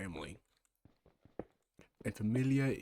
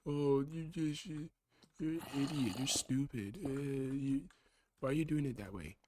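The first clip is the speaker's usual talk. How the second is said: higher, slow mock-nagging voice; mocking nag; 5 semitones higher than usual. Quoting the nagging other self, mocking. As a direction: mimic the critic who always nags. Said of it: "always telling me".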